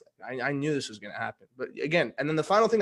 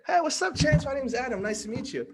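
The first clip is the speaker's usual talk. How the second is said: high, bright-eyed hello, much higher pitch; upbeat greeting; 9 semitones higher than usual. Retold or imitated. Meeting Larry King's son, an eager friendly hello.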